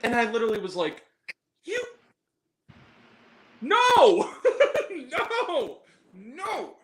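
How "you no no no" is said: high, stretched-out "no" through giggles; laughing mock scolding. Viewer telling off Tyler Perry, laughing mock scolding. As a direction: scold like a naughty pet, cracking up.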